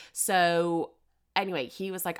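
ordinary talk

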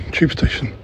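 ordinary talk

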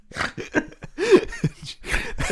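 breathy burst of laughter, high up; high airy laugh. Real, not acted. Friend laughing at his own retelling. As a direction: let a giggle break out high.

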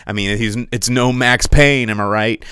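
ordinary delivery

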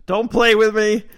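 mother warns her son to stop pretending, loud; stern mom. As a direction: snap it at him, no nonsense.

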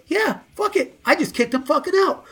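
high, offhand mimic of a bandmate; imitating a careless bandleader; mock dismissive. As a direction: toss it off like it's no big deal. Retelling John's blunt announcement.

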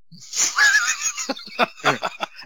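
big loud burst of laughter, high; cracking up at his own joke. Real, not acted. Laughing loudly after his comeback to trash talk.

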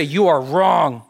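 loud, slow, stern condemnation; harsh verdict. Retold or imitated. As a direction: lay it down like a judge's ruling. Mocking harsh confronting believers.

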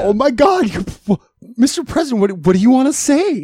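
high excited mock disbelief; shocked. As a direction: gush at the President. Shocked by presidential call.

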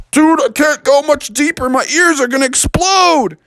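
high, pained little bear; strained bear. Acted. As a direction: ears hurting on the dive. Bear diving too deep.

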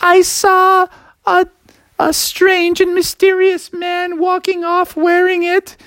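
very high, slow buzzing fly voice. Acted. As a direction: buzz the words like a fly. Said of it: "the fly was like".

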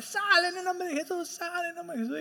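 high, urgent command to a demon, fading; ordering the demon out, rising high then trailing off; mock exorcist command. Ministers ordering the demon out, high urgent command. People shouting at a possessed man.

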